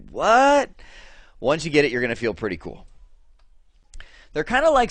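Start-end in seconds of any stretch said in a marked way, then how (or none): 0.1-0.7 high baffled what; mock bewildered outburst; confused what. Student confused by the slide, blurting a baffled what. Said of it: "you're gonna be like".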